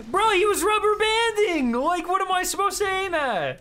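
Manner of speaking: high, loud outburst of disbelief; frustrated high-pitched shouting; exasperated yell. Real, not acted. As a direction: yell it high in gamer outrage. Frustrated yelling at the game.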